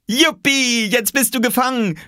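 put-on high, loud triumphant child; gleeful kid's victory shout; excited boy calling. A narrator's reading. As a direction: cheer it out in a very high boy's voice. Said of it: "rief Oskar".

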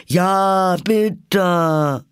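high, loud, drawn-out plea; chorus call. A narrator's reading. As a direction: chant it like a begging crowd. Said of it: "wiederholten im Chor".

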